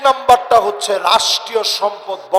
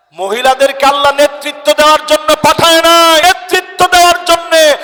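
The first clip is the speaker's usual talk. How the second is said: high, loud sermon shout; full-force preaching; 11 semitones higher, 11 dB louder than usual. Real, not acted.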